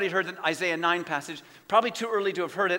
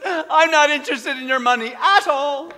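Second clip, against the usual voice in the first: laughing quote; 7 semitones higher, 8 dB louder than usual. Retold or imitated. Pastor's first sermon quoted, laughing.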